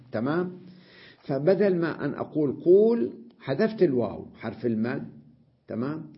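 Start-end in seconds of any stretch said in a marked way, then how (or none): none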